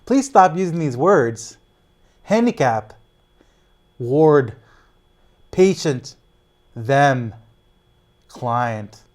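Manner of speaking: loud, each banned word called out alone; loud rally voice. Real, not acted. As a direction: call out the list to the crowd.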